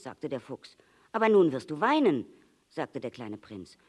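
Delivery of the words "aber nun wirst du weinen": loud, high, quick objection; upset protest. A narrator's reading. Little prince protests that the fox will cry, upset. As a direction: protest loudly and urgently.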